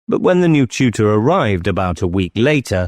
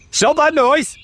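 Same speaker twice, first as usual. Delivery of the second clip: very high-pitched scolding; angry command. Acted. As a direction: order the crying child to stop. King scolds the crying boy.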